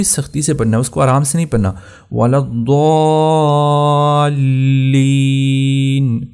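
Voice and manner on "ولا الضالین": ولا الضالین with hard doubled lam and long madd; الضالین held long, lam pressed hard; slow chanted ولا الضالین; stressed lam. Shown as a demonstration. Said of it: "لام کے اوپر آپ نے سختی کرنی ہے".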